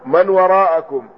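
"من وراءكم" as man waraa'akum; fatha reading. Shown as a demonstration. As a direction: open a in "من" and after the hamza of "وراءكم". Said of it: "هكذا ضبطناه". ("من وراءكم" بفتح الميم)

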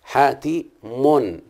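حاتي من said very slowly, syllables apart; slow syllable demo. Shown as a demonstration. Teacher scanning حاتم syllable by syllable, very slow. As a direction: scan it out beat by beat. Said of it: "التاء مكسورة يبقى أعمل شرطة".

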